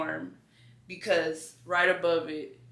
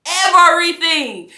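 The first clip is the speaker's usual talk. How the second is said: Everything cried out much higher and louder; building exclamation; 10 semitones higher, 13 dB louder than usual. Real, not acted. Preaching-style cry of Everything, God's full promise. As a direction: throw Everything out at full force.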